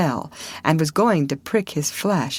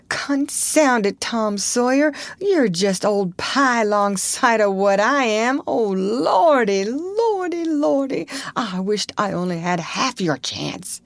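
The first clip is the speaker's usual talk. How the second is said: higher, wailing lament; snuffling; 6 semitones higher than usual. Acted. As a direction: wail Lordy, Lordy, near tears. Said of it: "Huckleberry began to snuffle too".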